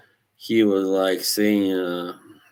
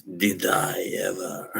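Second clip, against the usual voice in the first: put-on Glasgow accent; imitating a comedian's Glaswegian accent; Billy Connolly; 4 dB quieter than usual. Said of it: "In that Glasgow, you know, accent".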